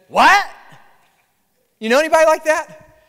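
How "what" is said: sharp, high, loud "What?"; instant "What?". Retold or imitated. Person who never listens answering, sharp loud "What?". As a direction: snap back "What?" without listening. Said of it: "instantly and automatically respond with".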